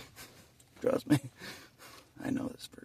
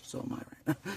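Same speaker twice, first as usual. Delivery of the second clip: ordinary delivery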